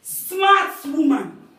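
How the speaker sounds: slow, loud, drawn-out praise; loud slow emphasis; emphatic praise. Real, not acted. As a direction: stretch each word out in admiration. Praising Tamar slowly.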